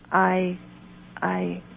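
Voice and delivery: a faltering, broken-off start; stammering. Acted. Patient stammering before the healer, halting. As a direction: stumble on the first word and stop. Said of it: "I stammered".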